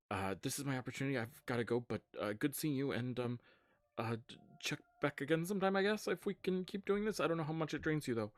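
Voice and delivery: fast, high, loud stammered farewell; hurried goodbye. Acted. Alum has got to go and says goodbye, hurried.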